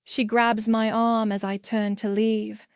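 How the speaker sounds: usual speaking voice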